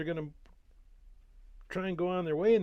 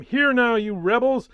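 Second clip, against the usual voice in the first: very loud, high furious rebuke; Moses rebuking; 8 semitones higher, 14 dB louder than usual. A narrator's reading. Moses shouting at the rebellious people before the rock, furious. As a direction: thunder it at the rebels. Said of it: "and he said to them".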